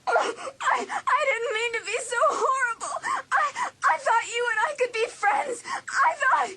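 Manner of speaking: high, faltering, broken by sobs; sobbing apology. Acted. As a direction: stammer through tears. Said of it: "Hallie's sobs quieted".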